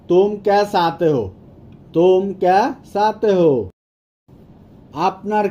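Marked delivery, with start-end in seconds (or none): 1.9-3.7 spaced-out words for language learners. Shown as a demonstration. Slower repeat for learners.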